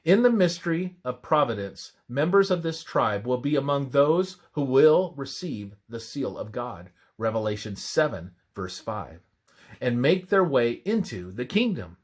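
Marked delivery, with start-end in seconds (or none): none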